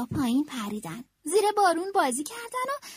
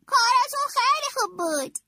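a higher, friendly bear voice; 9 semitones higher, 4 dB louder than usual. A narrator's reading. Bear congratulating the losing team, a friendly, higher bear voice. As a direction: sound like a kind animal friend offering praise. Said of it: "خرس گفت".

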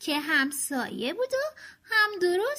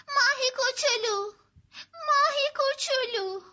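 desperate calling; 11 semitones higher than usual. Acted. Franklin searching the pond for his fish, frantic calling. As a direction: call out over the pond for a lost pet. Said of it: "داد زد".

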